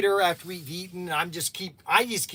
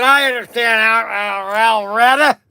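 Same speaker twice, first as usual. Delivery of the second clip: loud mock-drunk wail; imitated drunken blubber, very loud; tipsy blubbering; 2 semitones higher, 9 dB louder than usual. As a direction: wail it like a silly, sloppy drunk. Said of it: "kinda getting a little silly".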